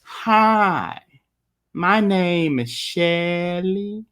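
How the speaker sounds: a slow, lightly drawn-out Alabama drawl; Southern drawl. Retold or imitated. Retelling a hotel clerk in Alabama with a light Southern drawl. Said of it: "conjectures in my mind when I heard".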